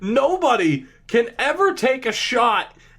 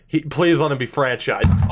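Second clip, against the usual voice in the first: ordinary talk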